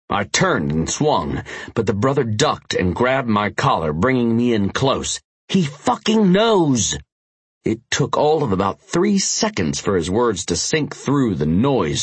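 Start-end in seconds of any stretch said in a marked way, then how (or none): 5.5-7.0 high, slow urgent yell; alarmed shout. Acted. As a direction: blurt the bad news with alarm.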